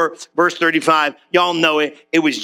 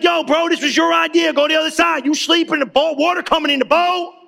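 higher, worked-up rant at a friend; hammed-up outrage, pitched high; mock outrage; 7 semitones higher than usual. Preacher pretending to scold sleeping Jesus, hammed-up outrage. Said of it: "I'd be like".

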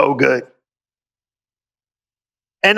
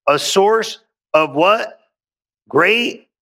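usual speaking voice

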